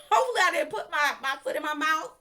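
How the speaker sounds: real embarrassed laughter while worrying aloud; laughing. Real, not acted. Laughing worry about offending.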